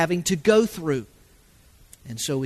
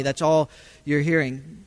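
ordinary talk, nothing marked

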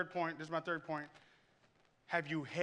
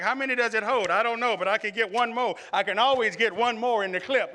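loud, eager mock musing; gleeful greed for more; preacher recalling himself as a kid loading a clip; 4 semitones higher, 9 dB louder than usual. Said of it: "I'd be like".

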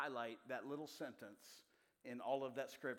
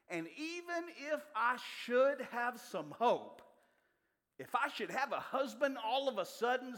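high, loud preaching climb, slowing; full-force preaching; 8 semitones higher, 7 dB louder than usual. Acted. Preacher reading Naomi's lament to the congregation, loud and high. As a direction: lift the scripture into a ringing preach.

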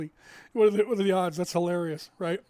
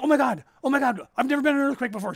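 mock panic; 8 semitones higher, 7 dB louder, faster than usual. Girlfriend panicking in earthquake.

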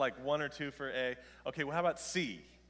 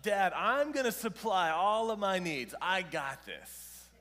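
put-on high, loud boasting kid; lightly voiced son, loud and proud; cocky son; 5 semitones higher, 10 dB louder than usual. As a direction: play the eleven-year-old bragging big plans. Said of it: "What if he just says".

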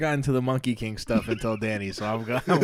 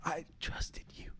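hushed, slow, breathy whisper; 5 semitones higher, 13 dB quieter, slower than usual. Retold or imitated. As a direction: whisper it slowly, betrayed. Betrayed friend voiced dramatically.

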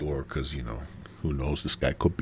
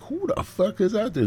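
wary startle. Retold or imitated.

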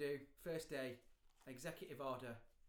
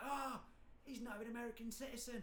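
higher-pitched mock shock; mock outcry; 8 semitones higher than usual. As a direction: act scandalised, in a high voice. Voicing staged outcry of conspiracy accusers.

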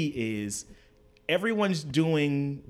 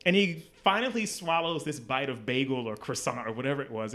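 ordinary talk, nothing marked

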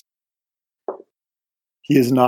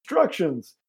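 yelling; 8 semitones higher, 5 dB quieter than usual. Retold or imitated. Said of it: "Maggie is yelling in your ear".

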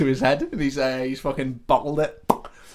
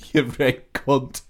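slow mock-Yorkshire insult; Sean Bean; slower than usual. Darts player insulting the man to his face, slow put-on Yorkshire. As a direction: drag out the insult in a Yorkshire accent. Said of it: "gonna do a Sean Bean".

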